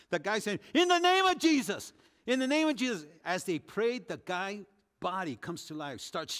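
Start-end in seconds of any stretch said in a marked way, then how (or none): 0.7-3.1 high, loud, insistent cry; loud repeated prayer shout; fervent shout. Retold or imitated. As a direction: call out the name of Jesus with full faith. Convert shouting prayer, retold.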